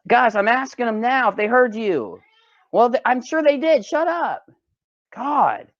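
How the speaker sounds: mock exasperation. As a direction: squabble playfully, pitch climbing. Mock quarrel with the singing cat.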